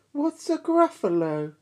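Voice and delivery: high, puzzled snake; curious snake. Acted. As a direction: ask it high and wondering. Snake asks.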